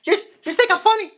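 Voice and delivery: very high, fast squeaky menace; rushed cartoon gangster; Joe Pesci. Another Pesci go, very high and fast.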